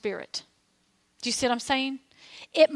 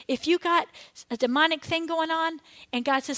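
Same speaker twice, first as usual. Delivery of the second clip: ordinary talk, nothing marked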